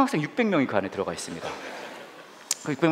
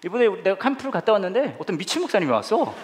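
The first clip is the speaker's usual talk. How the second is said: put-on excited child; 6 semitones higher than usual. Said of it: "아빠한테 이렇게 얘기한 거예요".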